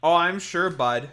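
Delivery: mock Canadian banter, sarcastic and loud; playing an exaggerated Canadian heckler; overly Canadian. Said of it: "why are you being overly Canadian".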